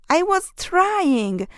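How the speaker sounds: high, slow, drawn-out complaint; whiny protest. A narrator's reading. Hilary whines back at her mom, slow and high. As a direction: stretch it out like a sulky kid. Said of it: "said Hilary".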